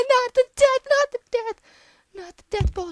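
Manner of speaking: real panicked squeal; panicked cry. Real, not acted. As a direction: beg the ball to miss. Ball heading to death.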